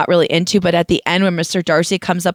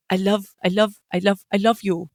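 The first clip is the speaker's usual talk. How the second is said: a lightly voiced, softer Mister Darcy repeating "I love"; hint of a stammering romantic hero, a bit quieter; 4 dB quieter than usual. Mocking Darcy's love confession to a friend, lightly voiced. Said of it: "when Mister Darcy's like".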